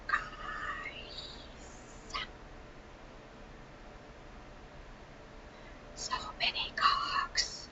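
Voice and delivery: hushed, slow conspiratorial whisper; breathy stage whisper about the book. Acted. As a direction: lean in and whisper it like a naughty secret. Aside about four male leads.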